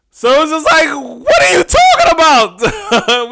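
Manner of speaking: high, loud and slow, half laughing; incredulous outcry. Real, not acted. Reacting to a baffling answer, a high disbelieving shout. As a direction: throw up your hands in disbelief.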